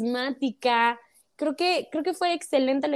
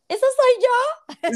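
very high, slow squeal; real delighted disbelief; 13 semitones higher, 4 dB louder, much slower than usual. Real, not acted. Star seeing herself on the cover, amazed.

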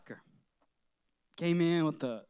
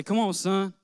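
louder, higher fatherly encouragement, gently upbeat; encouraging father; 5 semitones higher, 5 dB louder than usual. Retold or imitated. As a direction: cheer the boy back onto his feet. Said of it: "He'd say".

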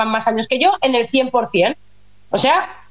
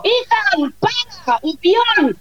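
high mock yell at a child; imitating herself calling her son from a distance; calling out from afar; 7 semitones higher, much slower than usual. Mother retelling shouting at her son Ethan, mock yell. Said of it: "al niño le dices de lejos".

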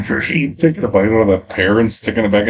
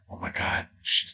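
hushed, low breathless whisper; mock awestruck whisper; 5 semitones lower, 10 dB quieter than usual. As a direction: whisper it in awe. Mia Kirshner's gasped realization.

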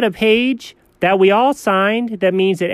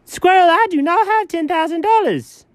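very high, indignant denial; comic high panic; panicked protest; 12 semitones higher than usual. Acted. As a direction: protest the bill in a high squeal. Protesting a demand for money.

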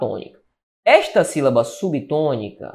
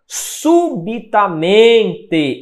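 "Subitamente" very slow and high, syllable by syllable, MEN drawn out strong; stress on MEN in SUBITAMENTE; 5 semitones higher, 3 dB louder, much slower than usual. Shown as a demonstration. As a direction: stretch "Subitamente" and lean on MEN. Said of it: "A sílaba tônica". ("Subitamente" bem devagar, com MEN forte e alongado)

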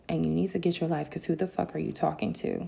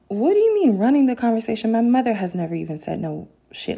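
loud, higher-pitched outraged challenge; indignant; 4 semitones higher, 6 dB louder than usual. Retold or imitated. Challenging her claim to run things, indignant. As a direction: throw the question back with force.

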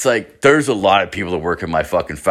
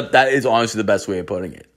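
ordinary talk

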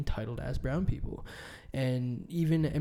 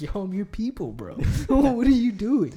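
breaks into a laugh, pitched up and louder; laughing disbelief; 8 semitones higher, 6 dB louder than usual. Retold or imitated. Complaining about the hostile neighbours, laughing in disbelief. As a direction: laugh it out in mock outrage. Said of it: "'cause I'm like".

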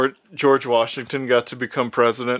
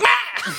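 very high gremlin squawk; high creature yelp; John Adams shriek. Retold or imitated. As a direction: screech like a startled goblin. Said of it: "And he's like".

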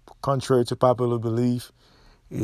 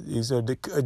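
usual speaking voice

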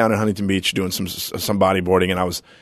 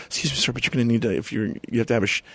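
meek manager; 7 dB quieter, faster than usual. Retold or imitated. Manager's polite request retold.

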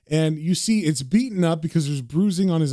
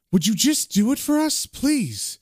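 higher-pitched acted plea; pleading; 7 semitones higher than usual. Caller begs Chaz for a favour, pleading. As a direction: coax with a wheedling please.

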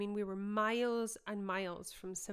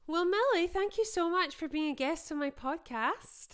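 higher and louder, upbeat thank-you to the guest; cheerful host outro; bright podcast sign-off; 9 semitones higher, 8 dB louder than usual. Real, not acted. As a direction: lift the energy to close the show.

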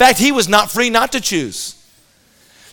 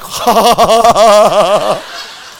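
put-on a loud boyish burst of laughter; gleeful group laugh; 4 dB louder than usual. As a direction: laugh like kids waiting for a crash. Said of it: "we're all like".

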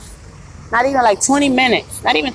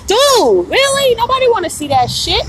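disgusted disbelief; 10 semitones higher, 3 dB louder than usual. Real, not acted. Disgusted at customer's crack.

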